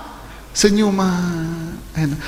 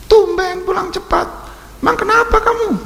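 higher, prying wife's voice; a wife eyeing her husband who came home early; mock suspicious wife; 9 semitones higher than usual. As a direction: needle him like a suspicious wife.

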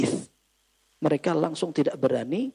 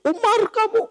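much higher, louder indignant cry; indignant outburst; 14 semitones higher, 6 dB louder than usual. Retold or imitated. Preacher retelling the Bedouin's insolence, loud and high. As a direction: blurt out the rude address in outrage. Bedouin's rude address to Umar.

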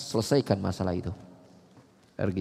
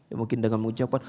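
ordinary delivery

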